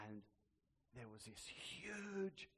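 usual speaking voice

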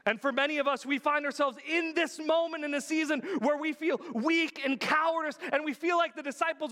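high, loud building sermon; impassioned preaching. Real, not acted. Pastor pressing the point to his congregation, loud and high. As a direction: preach it with rising urgency.

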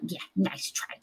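hushed, sinister spirit voice; horrible voice. Retold or imitated. Unseen being on the stairs taunting the witch, horrible hushed voice. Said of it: "like, this kind of voice".

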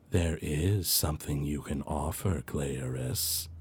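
a low whispering unseen spirit; ghostly entity. Acted. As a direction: speak from beyond, hollow and low.

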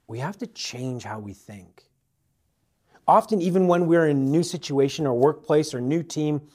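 ordinary talk

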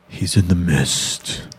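low, slow eerie warning; ominous warning. Retold or imitated. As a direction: say it like you see something in the fog. Acted quote, spooked whisper-ish.

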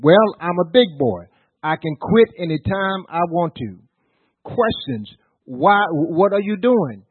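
full-force pulpit preaching; fiery preaching. Acted. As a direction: drive it home to the congregation.

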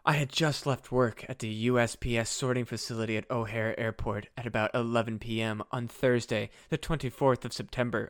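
low, soft dramatic narration; dramatic reading. Acted. Host reading a Mothman sighting report, hushed dramatic reading. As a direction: read it out as a solemn witness account. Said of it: "I'm gonna do a dramatic reading".

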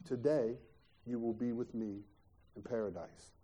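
softly voiced Jesus promising paradise; Jesus, gently. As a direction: give the promise quietly and tenderly. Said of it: "Jesus says to him".

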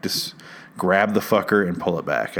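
ordinary talk, nothing marked

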